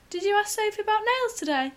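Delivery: high, bright mum voice asking; a high-pitched mum voice; mimicking mum. Daughter imitates her mum asking about nails, high voice.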